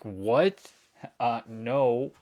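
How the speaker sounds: retold outraged reply; incredulous. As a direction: throw back a flat refusal. Retelling own disbelieving reply.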